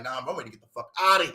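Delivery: fast, low rush to leave; nervous guest. Retold or imitated. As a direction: mumble it quickly, wanting out.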